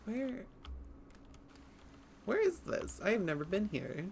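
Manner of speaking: startled. Real, not acted. Lost in unknown place.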